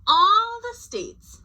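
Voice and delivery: high, loud, slow on All; dramatic emphasis. A narrator's reading. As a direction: stretch All for suspense. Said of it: "dramatic narration pause".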